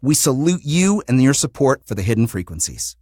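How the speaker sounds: normal talking voice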